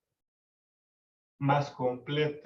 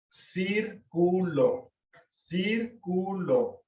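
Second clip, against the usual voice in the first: "círculo" very slow and higher, in three split syllables; stress on antepenultimate syllable; 4 semitones higher, much slower than usual. Shown as a demonstration. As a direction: sound out CÍR-cu-lo for a class, leaning on CÍR. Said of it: "cir es la antepenúltima". ("círculo" muy lento, silabeado, con acento en CÍR)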